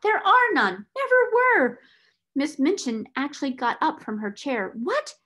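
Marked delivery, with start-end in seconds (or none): 0.0-1.8 much higher and louder outburst. A narrator's reading. Solicitor bursting out at the headmistress, high and loud. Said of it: "he broke out". 4.7-5.1 shocked cry, much higher. A narrator's reading. Headmistress crying out to the solicitor in shock. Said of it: "she cried".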